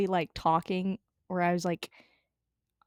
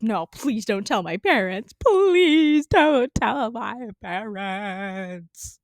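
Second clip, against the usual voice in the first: drawn-out desperate begging; mock tearful plea; pleading; much slower than usual. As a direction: beg them, slowing right down. Pleading not to tell parents.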